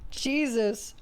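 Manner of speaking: ordinary delivery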